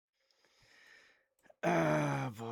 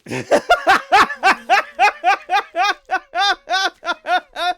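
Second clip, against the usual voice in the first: long, loud, high cackling laugh; loud rolling laughing fit; real burst of laughter; 10 semitones higher, 12 dB louder than usual. Real, not acted. Bursts out laughing mid-game.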